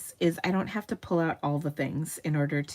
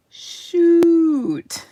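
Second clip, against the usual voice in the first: loud, much higher laughing Shoot; covering a curse, high and loud with laughter; laughing exclamation; 11 semitones higher, 11 dB louder than usual. Real, not acted. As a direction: squeal the word through a laugh. Laughing, minced curse after spill.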